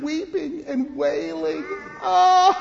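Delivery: high, hammed-up wail; mock wailing. Pastor to the children, acting out mock wailing. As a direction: cry out like a mourner. Mourners weep in story.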